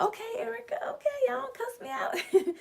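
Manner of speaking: squeaky high-pitched begging, half crying; playful high whimper asking not to be cussed out; high whiny plea. Real, not acted. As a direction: plead in a high whine. Pleading with someone off camera.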